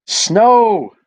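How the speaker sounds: high, loud burst of delight; excited shout. Acted. Children finding snow on Christmas morning, thrilled. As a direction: cry it out with wonder.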